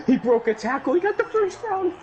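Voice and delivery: high, rising disbelief; excited. Real, not acted. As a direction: shriek at the lucky play. Surprised excited play reaction.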